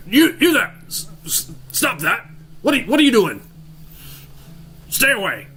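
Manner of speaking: high-pitched yell without real volume; mock yell. Davian breaking his silence at the dancers, yelling high. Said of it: "Davian yells".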